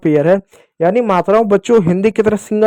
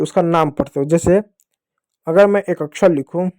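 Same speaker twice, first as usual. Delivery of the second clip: normal talking voice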